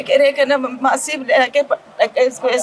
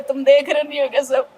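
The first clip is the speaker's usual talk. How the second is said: ordinary talk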